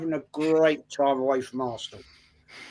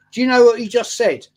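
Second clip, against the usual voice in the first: wife's challenge, raised in pitch and volume; mock indignant quote; 6 semitones higher, 7 dB louder than usual. Wife challenging husband on his nonsense, mock indignant. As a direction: imitate an affronted wife, louder and higher.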